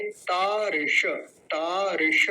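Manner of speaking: तारिश very slowly, long ता; retroflex श in तारिश. Shown as a demonstration. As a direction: stretch तारिश and hold the retroflex sibilant. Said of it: "मूर्धन्य शकार का विशेष ध्यान रखेंगे". (तारिश में मूर्धन्य शकार का धीमा उच्चारण)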